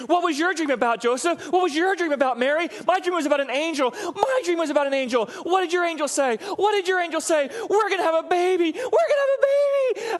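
hammed-up high squeal, climbing to a squeak; escalating giddy excitement. Preacher spoofs Mary and Joseph comparing dreams, escalating giddy squeals.

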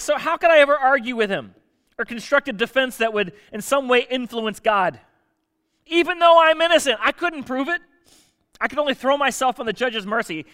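high, loud protest of innocence; exasperated Job. Acted. Job protests he cannot argue with God, exasperated. Acts out Job's hopeless legal plea.

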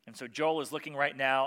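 normal talking voice

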